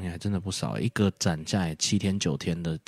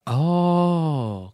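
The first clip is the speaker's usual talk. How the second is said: a high, louder drawn-out oh; surprised oh; 8 semitones higher, 4 dB louder than usual. Real, not acted. Hearing the friend wants to watch fishing clips, a high surprised oh. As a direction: react with sudden interest.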